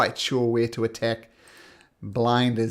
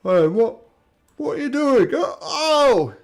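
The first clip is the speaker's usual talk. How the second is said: high, loud mock cries of a slashed giant; alarmed giant under attack; 12 semitones higher, 8 dB louder than usual. Game master as a giant being slashed, alarmed high cries. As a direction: yelp in hammed-up protest, climbing higher.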